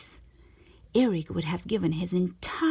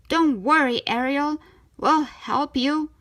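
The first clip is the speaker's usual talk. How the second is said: higher, louder reassurance, slowing; lightly voiced Scuttle; 6 semitones higher, 5 dB louder than usual. Scuttle reassuring Ariel on the way to the ship. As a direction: rush in to help.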